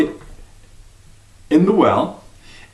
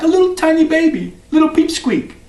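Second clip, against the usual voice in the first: much higher, squeaky little voice; tiny squeaky voice; 10 semitones higher than usual. A narrator's reading. Teller describes the tiny baby, squeaky.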